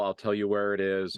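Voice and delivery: ordinary talk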